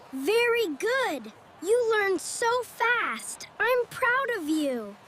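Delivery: ordinary delivery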